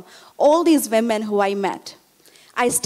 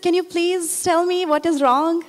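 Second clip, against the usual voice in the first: higher-pitched, gently insistent question; concerned plea; 7 semitones higher than usual. Retold or imitated. Student pressing a crying neighbor to explain, concerned plea. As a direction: press for an answer, voice lifted.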